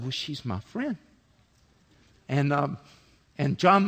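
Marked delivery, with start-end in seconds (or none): 0.0-1.0 soft, low retold child's answer; hushed tender reply. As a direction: quote the boy very softly and simply. Child's tender answer, story's climax.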